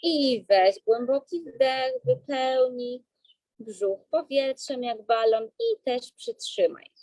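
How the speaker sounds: slow, calm breathing-coach voice; guided breathing. Acted.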